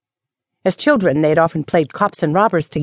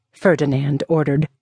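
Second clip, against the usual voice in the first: ordinary delivery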